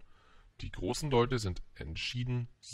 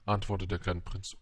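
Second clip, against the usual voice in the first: plain reading voice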